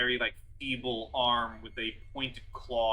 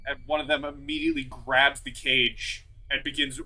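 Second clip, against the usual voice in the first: usual speaking voice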